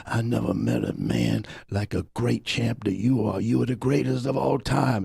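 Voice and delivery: put-on old admirer pouring out devotion; moved old man. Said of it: "it was so moving".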